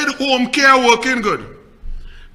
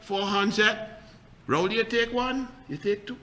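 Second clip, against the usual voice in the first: ordinary talk, nothing marked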